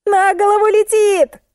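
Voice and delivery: very high, sustained squeal. A narrator's reading. Musenka warns narrator of the falling load, squealing. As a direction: squeal the warning out. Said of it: "завизжала Мусенька".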